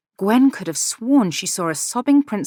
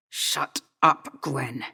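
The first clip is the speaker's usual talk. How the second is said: hissed; 5 semitones lower, 5 dB quieter, much slower than usual. A narrator's reading. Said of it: "he hissed".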